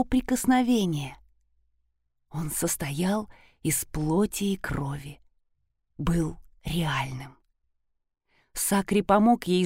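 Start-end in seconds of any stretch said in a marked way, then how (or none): 2.3-7.4 slow breathy whisper, hushed. A narrator's reading. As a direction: let it fall to a whisper. Narration drops to whisper.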